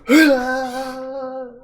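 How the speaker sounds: high, loud charging cry; sudden yelled burst; battle yell. Retold or imitated. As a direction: let out a war cry as you charge. Charging at the fence.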